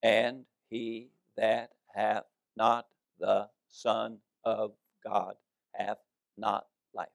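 slowly, every word weighed; slow emphatic reading. Shown as a demonstration. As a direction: read each one-syllable word slowly and deliberately. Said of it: "I'm gonna say it real slow so I can emphasize".

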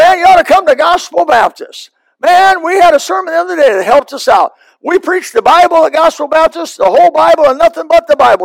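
high, eager pitch to a friend; enthusiastic recommending. Pretend. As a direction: talk up your church with excitement. Preacher models telling others about church.

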